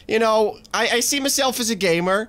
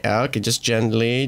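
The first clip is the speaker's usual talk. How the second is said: ordinary talk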